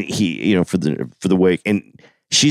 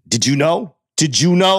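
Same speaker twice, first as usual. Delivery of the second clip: high, repeated accusing question; retold demanding question; 7 semitones higher, slower than usual. Sly confronting the old man, demanding. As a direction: push the repeat higher, demanding an answer. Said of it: "when he's like".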